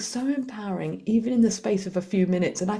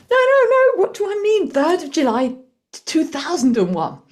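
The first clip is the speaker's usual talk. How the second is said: much higher and louder, a flustered rush to fix the mistake; flustered correction; 10 semitones higher, 8 dB louder than usual. Retold or imitated. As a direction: catch your own blunder and blurt out the right year. Flustered self-correction at pharmacy.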